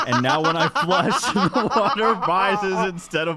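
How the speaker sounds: laughing through the words; giggling while telling the flush disaster. Real, not acted. Laughing while confessing the toilet overflowed.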